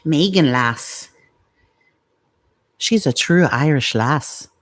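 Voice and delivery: lilting, put-on Irish accent. Shown as a demonstration. Showing how to say the listener's name, in an Irish accent. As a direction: stretch it out like a stage Irish speaker. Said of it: "have to say with the Irish accent".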